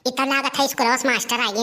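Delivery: normal talking voice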